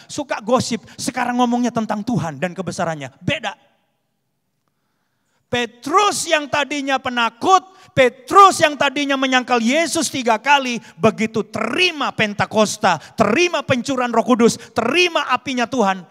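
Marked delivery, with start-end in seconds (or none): 5.5-16.0 loud, high, driving sermon delivery; fervent preaching. Real, not acted. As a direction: build it phrase by phrase at full force. Preaches at full force. (berkhotbah berapi-api dengan suara lantang)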